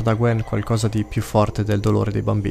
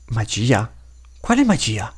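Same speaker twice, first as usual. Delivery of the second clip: put-on curious child; slower than usual. A narrator's reading. Child questioning the living toy, curious. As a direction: ask in a puzzled child's voice. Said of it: "chiede un bambino".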